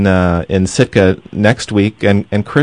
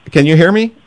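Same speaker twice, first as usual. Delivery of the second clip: raised, higher voice down the line; shouting into a phone; 10 semitones higher, 3 dB louder than usual. Real, not acted. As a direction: call out over a bad connection. Host checks if guest hears.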